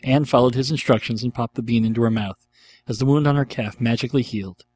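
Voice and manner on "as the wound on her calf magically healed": ordinary delivery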